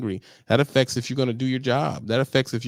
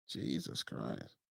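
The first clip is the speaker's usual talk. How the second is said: ordinary delivery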